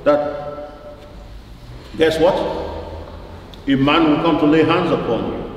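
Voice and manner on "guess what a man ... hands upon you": ordinary delivery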